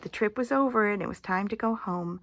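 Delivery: plain reading voice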